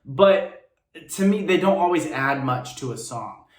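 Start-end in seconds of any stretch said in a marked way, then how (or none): none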